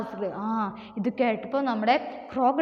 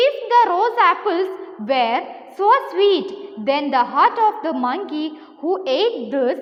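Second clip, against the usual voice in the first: slow, deliberate classroom story reading; story read-aloud; 3 semitones higher, 4 dB louder, much slower than usual. A narrator's reading. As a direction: read it out slowly, phrase by phrase. (സാവധാനം കഥ വായിച്ചു കേൾപ്പിക്കുന്നു)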